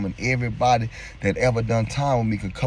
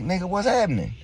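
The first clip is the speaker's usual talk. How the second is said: surprised greeting; 8 semitones higher, faster than usual. Retold or imitated.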